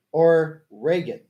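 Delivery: normal talking voice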